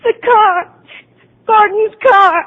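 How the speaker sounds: high, strained tearful recall. Acted. Patient reliving the night for the doctor, tearful. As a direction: remember it through tears.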